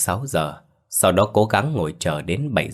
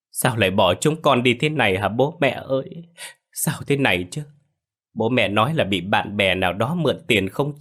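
crying lament; 3 semitones higher than usual. Acted. Liễu resumes crying before relatives, feigned grief. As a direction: keep sobbing out questions to the dead. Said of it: "Liễu lại khóc".

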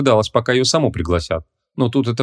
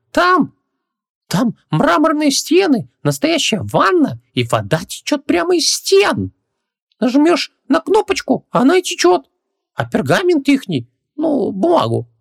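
very high djinn, slow and marvelling; tiny high voice, drawn-out awe; Хубаксис, amazed; slower than usual. Acted. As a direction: savour each wonder in a squeaky voice. Djinn amazed by modern bathroom.

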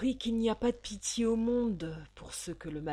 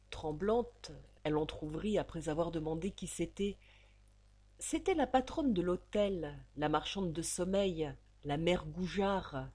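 ordinary delivery

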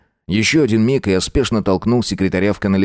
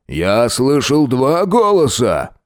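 slow, lightly voiced brute; a hint of a booming thug; big brute; 5 semitones higher, slower than usual. A narrator's reading. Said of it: "гулким басом заявил Громила".

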